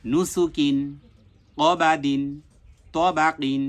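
নুসুকিন, ক বা দিন, ত বা কিন, each as one word; joined-up reading. Shown as a demonstration. Teacher rereads the whole line, each word joined up. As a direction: read each word whole, pausing between words. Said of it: "আবার এই লাইনটা পুনরায় আমরা reading পড়ব".

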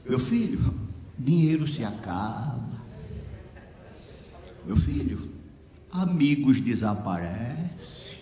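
lightly voiced weary counsel, low endings; halting advice from a sickbed, with long pauses; frail sick woman. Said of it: "ela começou a dizer".

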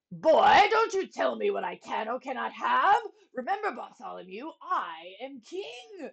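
loud, commanding royal scolding; angry king. Acted. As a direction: put the boy in his place.

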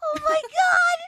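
high, thrilled fan scream; excited squeal. Real, not acted. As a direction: squeal like meeting your idol.

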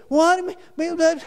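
high mock whining; whining complaint. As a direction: whine like a child told to get up. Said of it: "we whine about everything".

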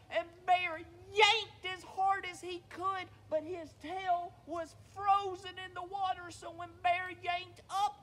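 high, loud, urgent storytelling; excited narration. A narrator's reading. Storyteller tells how Bear loses his tail, excited narration.

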